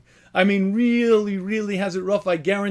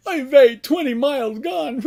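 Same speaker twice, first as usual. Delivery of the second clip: higher, louder hammed-up lament; mock crying; 6 semitones higher, 4 dB louder than usual. Rabbi mocking a marathoner who weeps at mile twenty. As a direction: moan like a runner who missed the point. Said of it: "You wouldn't cry".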